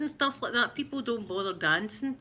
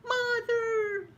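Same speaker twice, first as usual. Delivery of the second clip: Bambi calling mother; 13 semitones higher than usual. Retold or imitated. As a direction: call out like a lost fawn. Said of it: "You hear the".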